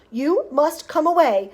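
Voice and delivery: loud, measured scolding order; agitated cry. A narrator's reading. Wonka to the boy dirtying his chocolate, agitated cry. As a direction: order him away, loud and emphatic. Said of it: "cried Mister Wonka, hopping up and down".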